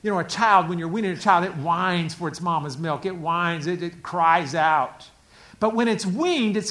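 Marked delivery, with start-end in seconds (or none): none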